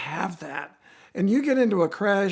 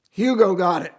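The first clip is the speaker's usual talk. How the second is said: imitated Southern dad's accent; strong Southern accent. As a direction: deliver it matter-of-factly in a put-on Southern accent. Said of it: "they got strong Southern accent".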